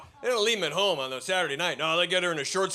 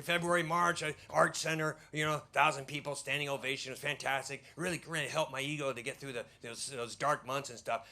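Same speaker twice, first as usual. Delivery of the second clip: reminiscing comedian; 3 semitones lower, 6 dB quieter than usual. Retold or imitated. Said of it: "A lot of comedians are reminiscing".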